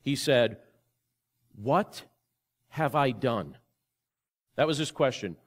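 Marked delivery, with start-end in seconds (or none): 1.5-3.6 broken up by long pauses, a touch of grief; grieving quote. Retold or imitated. Retelling a friend's call after his affair, mildly grieving. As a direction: let each word hang, stunned with regret. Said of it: "he said these words with such grief and such pain".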